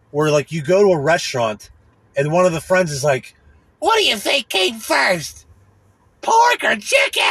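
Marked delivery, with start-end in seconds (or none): 3.8-7.2 high, slow, shrieking mockery; mocking high voice. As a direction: screech it like a clueless idiot. Mocking friend's question.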